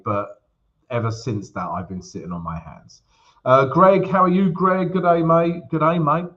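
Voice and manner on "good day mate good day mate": playful mock Aussie greeting; Aussie accent. Streamer greets viewer Greg in the live chat, mock Aussie accent. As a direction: greet in a put-on Australian accent, raised pitch. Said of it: "It's my Aussie accent".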